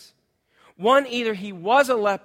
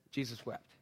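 hushed and solemn; 9 semitones lower, 16 dB quieter than usual. Acted. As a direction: drop the voice low and quiet. Solemn shortest verse.

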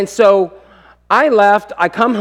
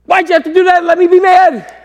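high, loud comic protest; exasperated yell; 14 semitones higher, 7 dB louder than usual. Retold or imitated. Dad disarmed by son's apology, exasperated yell. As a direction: wail it out in mock frustration.

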